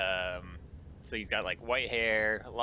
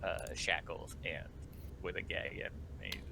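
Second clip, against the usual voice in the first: normal talking voice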